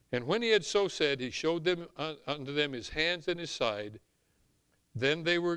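usual speaking voice

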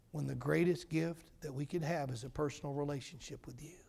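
soft, earnest prayer voice; closing prayer. Acted. Preacher prays over the congregation in a soft, earnest voice. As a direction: pray quietly over the room.

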